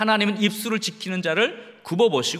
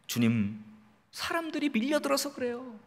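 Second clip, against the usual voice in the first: soft, higher-pitched disciple explaining to his master; hushed, lifted voice, making a gentle excuse; 베드로 흉내; 5 semitones higher, 9 dB quieter than usual. Acted. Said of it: "오히려 베드로가". (조심스럽게 높고 작은 목소리로)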